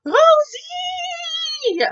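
high, loud panicked call of a name; frightened yell. Acted. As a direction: shriek the friend's name in alarm. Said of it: "il crie".

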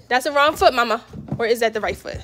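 ordinary talk

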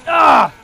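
sharp loud yelp of pain; a sudden startled cry as the hit lands; pained shout. Real, not acted. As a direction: gamer yelping when shot. Gamer gets hit mid-fight.

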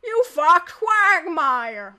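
loud, drawn-out cartoon character voice; Cleveland. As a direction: attempt a big slow cartoon impression. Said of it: "I can do Cleveland, too".

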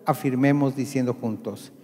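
normal talking voice

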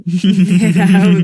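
laughing. Real, not acted. Laughing with the baby.

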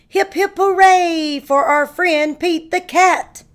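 high, loud, festive hooray; loud cheer. Acted. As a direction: lead the crowd in a cheer.

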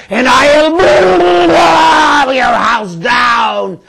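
big bad wolf, slow and swelling; wolf voice, building to a roar. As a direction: threaten slowly, end in a roar. Wolf threatens the brick house.

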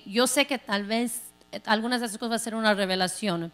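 ordinary delivery

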